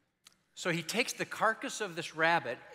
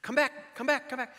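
calling the dog back; 8 semitones higher, 4 dB louder than usual. Acted. Hunter calls his dog back.

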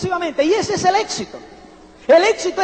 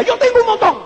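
más agudo y más fuerte, como una moto; remedo eufórico; 7 semitones higher, 4 dB louder, faster than usual. Retold or imitated. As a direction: imita a alguien acelerado presumiendo. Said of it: "me decía". (imitación eufórica y aguda)

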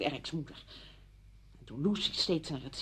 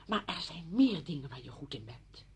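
usual speaking voice

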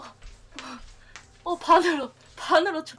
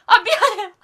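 usual speaking voice

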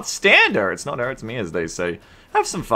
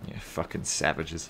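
muttered curse; 8 semitones lower, 15 dB quieter, slower than usual. Real, not acted. Muttering at other players.

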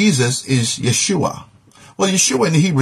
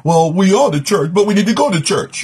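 lightly mocking churchgoer, pitched higher; mimicked double talk; 6 semitones higher than usual. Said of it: "there's a double talk going on".

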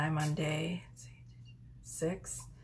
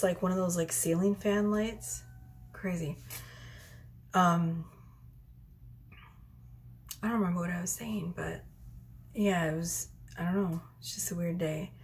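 usual speaking voice

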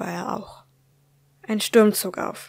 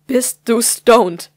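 slow, louder, every word weighed, stress on du; slow menacing interrogation; 3 semitones higher, 11 dB louder, much slower than usual. Acted. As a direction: drag it out like a final warning. Mother asks again, slapping.

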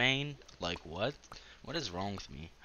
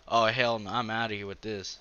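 ordinary talk, nothing marked

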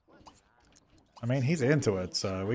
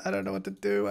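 high, soft, helpless worry; quietly panicked, pitched up; 6 semitones higher, 7 dB quieter, faster than usual. Real, not acted. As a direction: fret in a small high voice. Panicking at the game, first take.

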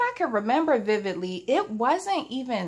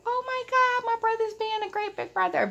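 put-on high, thrilled child's voice; gushing little sister. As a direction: gush about the great big brother. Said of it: "ninety-nine percent of my brain was like".

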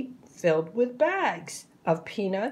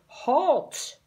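higher-pitched ringmaster's call; mild shout; 6 semitones higher than usual. A narrator's reading.

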